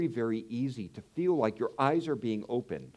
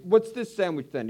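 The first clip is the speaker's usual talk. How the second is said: mock angry dad; 7 semitones higher, 6 dB louder than usual. Angry dad confronts son.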